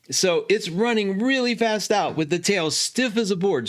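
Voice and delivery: ordinary delivery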